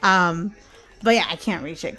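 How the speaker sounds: usual speaking voice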